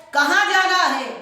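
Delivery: high, loud furious demand; angry uncle. Retold or imitated. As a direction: bark the question at him like an enraged uncle. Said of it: "وہ غصے میں کہتا ہے".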